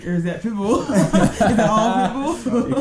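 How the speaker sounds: amused question breaking into laughter; real giggling disbelief, drawn out at the end; laughing. Real, not acted. Laughing at own doubt about singer.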